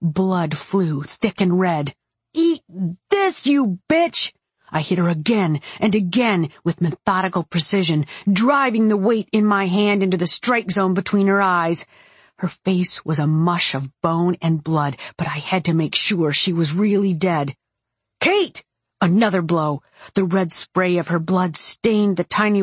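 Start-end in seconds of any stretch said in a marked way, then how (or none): 2.3-4.3 woman yelling as she bludgeons her attacker; furious yell. A narrator's reading. Kate smashing the attacker. 18.2-18.6 sharp, much higher shout of her name; calling out. A narrator's reading. Luther calling to stop her.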